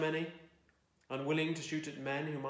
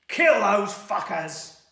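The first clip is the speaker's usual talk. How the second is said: much higher, very loud, slow raging yell; furious war cry; 7 semitones higher, 16 dB louder, slower than usual. A narrator's reading. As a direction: scream the mob into a charge. Rider urging the mob on.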